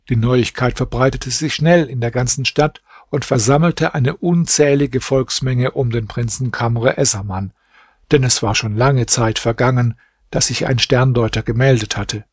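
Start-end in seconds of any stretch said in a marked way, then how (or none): none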